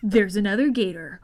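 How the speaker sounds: ordinary delivery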